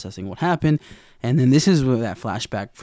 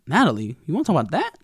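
defensive surprise; 6 semitones higher, 4 dB louder, much faster than usual. Retold or imitated. Randy questioned about Natalie.

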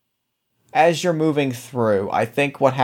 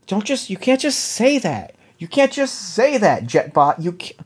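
high protest; 6 semitones higher than usual. Acted. Player protests at timid birds.

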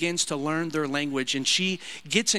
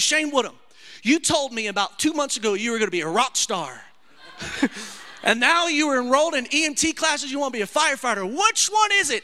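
higher, louder scolding mother; exasperated mom; 9 semitones higher, 5 dB louder than usual. Retold or imitated. Mother scolding her son for changing plans, higher and louder. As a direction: scold him like a fed-up mom. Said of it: "She goes".

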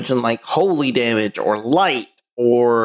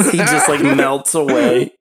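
normal talking voice

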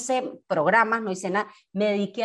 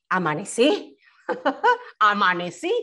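laughing relief. Real, not acted. Grateful waking, laughing.

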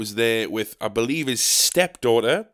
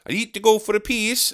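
higher-pitched imitation of a Polish accent; mock Polish-accented English; slight Polish accent; 7 semitones higher than usual. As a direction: mimic a Polish speaker, long ee in "piece". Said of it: "says in Polish with a slight accent".

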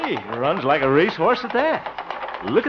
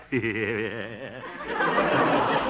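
low, soft drawn-out chuckle; 7 semitones lower, 6 dB quieter than usual. Acted. Harold chuckles softly to promote the laugh contest. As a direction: chuckle to show how to laugh.

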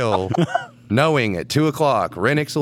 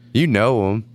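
usual speaking voice

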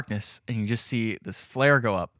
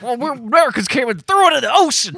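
dumb American yokel; 13 semitones higher, 8 dB louder than usual. Pretend. Bragging about the tea in the ocean, mock yokel voice. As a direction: boast like a hammed-up dim patriot.

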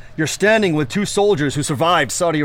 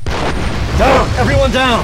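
yelling an urgent order, higher; shouted command; 7 semitones higher, 6 dB louder, much slower than usual. Acted. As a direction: bark it out to take cover. Soldier orders everyone to take cover.

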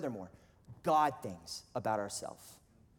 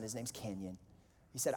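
usual speaking voice

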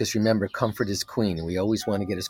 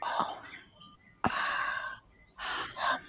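breathy whispered mantra, barely audible; hushed whisper of the mantra; 13 dB quieter, much slower than usual. Shown as a demonstration. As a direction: whisper the syllables, almost silent. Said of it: "And now whisper it".

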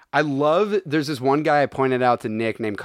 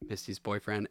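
low, much softer quote; imitated movie line; 6 semitones lower, 14 dB quieter than usual. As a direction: drop it low and quiet, mimicking the character. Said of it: "Where he's like".

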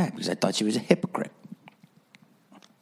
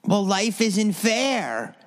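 higher, slow, dismissive therapist voice; mocking the therapist's line; 10 semitones higher, much slower than usual. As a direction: drag it out like a smug therapist. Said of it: "And she goes".

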